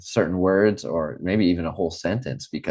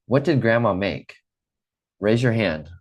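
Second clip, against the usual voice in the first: usual speaking voice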